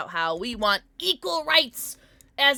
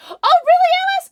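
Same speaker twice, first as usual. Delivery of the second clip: loud high delighted surprise; surprised squeal; 14 dB louder, faster than usual. Real, not acted. Reacting to a viewer.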